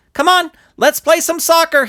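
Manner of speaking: put-on a kid calling his friend to play; excited kid. Excited kid drags Lump.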